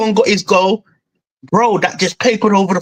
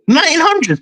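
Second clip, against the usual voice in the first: pitched up high in disbelief on hundreds; incredulous echo; 7 semitones higher than usual. Shown as a demonstration. Fan repeating the stat to his co-host, disbelieving. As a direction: repeat it back like you can't believe it. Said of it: "Are you fucking dumb".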